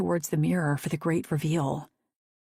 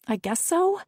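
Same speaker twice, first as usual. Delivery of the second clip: higher, unsure little reply; hesitant; 14 semitones higher than usual. A narrator's reading. Daisy answering the stylist, unsure. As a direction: shrug it off, uncertain.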